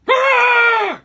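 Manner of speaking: loud high scream; startled scream. Real, not acted. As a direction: scream in sudden fright. Startled by something.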